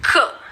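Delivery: ख short and throaty; phonics k sound. Shown as a demonstration. Teacher giving the k sound like the c sound. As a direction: push ख out from the throat. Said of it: "जैसे हमने सी की sound की थी".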